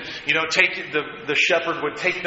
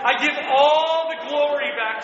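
fervent praise; 10 semitones higher than usual. Shown as a demonstration. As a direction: lead the room in lifting thanks to God. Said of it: "can we say that".

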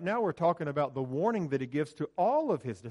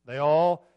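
"all" punched much louder; stress on all; 9 dB louder than usual. Shown as a demonstration. Preacher to congregation, slow loud weight on "all". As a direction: lean hard on "all", louder. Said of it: "The word all appears three times".

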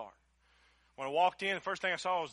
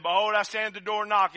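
loud, higher ringing proclamation; proclaimed loudly; 5 semitones higher, 9 dB louder than usual. Shown as a demonstration. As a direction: call the verse out over the room. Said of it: "And he said".

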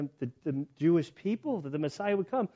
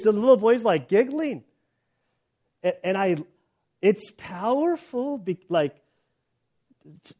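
ordinary delivery

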